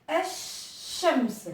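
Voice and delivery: الشمس said as if with two shins; الشمس with a geminated sh; doubled consonant. Shown as a demonstration. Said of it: "c'est comme s'il y avait deux consonnes".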